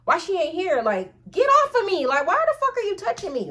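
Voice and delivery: retold outburst, high and agitated; indignant, rising disgusted protest. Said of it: "I was just so disgusted".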